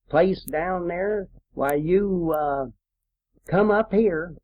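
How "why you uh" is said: usual speaking voice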